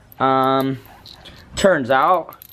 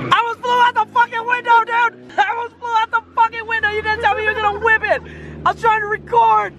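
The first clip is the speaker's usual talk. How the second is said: very high, strained yelling; reenacted panic yell. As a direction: relive the scare, shouting. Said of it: "I don't know if you guys watched".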